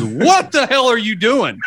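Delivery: high, outraged woman waking up; shocked girl. Retold or imitated. Girl waking mid-prank yelling at cousin, high and outraged. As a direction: play the girl jolted awake, appalled.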